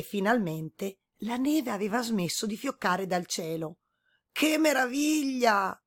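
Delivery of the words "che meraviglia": put-on old man exclaiming. A narrator's reading. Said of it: "esclamò rivolto al suo cane".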